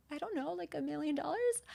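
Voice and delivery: small, high, soft uncertain answer; intimidated. Retold or imitated. Client answering a coach's money question, intimidated. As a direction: venture it timidly, high and hushed. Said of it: "I was, you know, intimidated".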